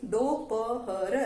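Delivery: दोपहर drawn out slowly, syllable by syllable; teacher sounding out दोपहर slowly for children. Shown as a demonstration. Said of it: "इसको क्या बोलेंगे".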